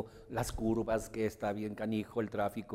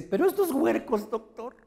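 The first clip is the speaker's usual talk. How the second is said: much higher, voice cracking with tears; high, tearful complaint to the doctor; imitating breaking voice; 11 semitones higher, 6 dB louder than usual. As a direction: play the upset mother on the verge of crying. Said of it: "se le quiebra".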